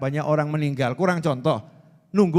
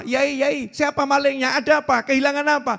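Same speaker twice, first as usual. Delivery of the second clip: alarmed villagers; 7 semitones higher, 3 dB louder than usual. Acted. Alarmed villagers ask cleric.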